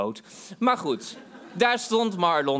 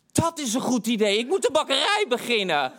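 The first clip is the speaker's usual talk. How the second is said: high-pitched, giddy excitement; acted manic enthusiasm; excited brainwave; 7 semitones higher than usual. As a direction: burst out with a sudden great idea. Acts out his manic brainwave.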